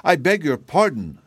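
put-on confused girl, halting after the first word; stammering. A narrator's reading. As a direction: stumble into an embarrassed apology. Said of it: "stammered Betsy, blushing and confused".

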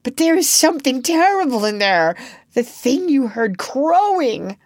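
high, loud terror; almost gibbering. A narrator's reading. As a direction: warn them, close to panic. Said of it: "almost gibbering".

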